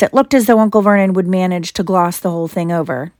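plain reading voice

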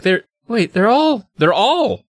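high, halting disbelief; dawning shock. Acted. Jerry realizes everyone is a robot.

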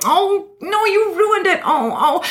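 imitating a deflated Barbara Walters in a high voice; mock heartbroken whine. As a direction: wail like someone whose magic got spoiled. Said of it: "She wanted to believe so badly".

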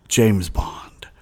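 hushed mock spy whisper. Host jokes about Bond's nemesis, in a hushed whisper. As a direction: breathe the name like a secret agent. Spy name aside after Bond.

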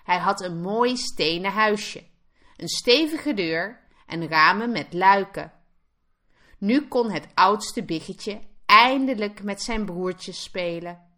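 usual speaking voice

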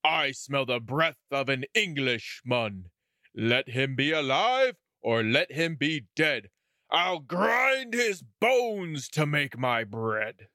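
lightly voiced giant, slow and menacing; a storybook giant sniffing out prey, unhurried; mild mock roar of a giant; giant's roar. A narrator's reading. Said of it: "he roared out".

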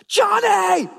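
sharp high shout across the street; yelling a child's name. Retold or imitated. Mother yelling at her son running into the street, a full shout. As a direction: call him back before he runs into the road. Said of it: "a mom yells as loud as she can".

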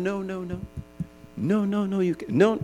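very soft string of gentle no's; soft repeated no. Retold or imitated. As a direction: coax the child away almost under your breath. Gentle parent stopping toddler.

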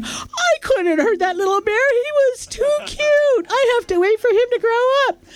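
a friend gushing, high and cooing. Retold or imitated. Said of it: "And he said".

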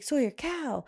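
put-on loud, high alert to a kid; imitating calling out. Uncle teases niece about a cow, mimicked calling out. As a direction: call it out like a sudden sighting.

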